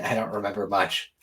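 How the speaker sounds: normal talking voice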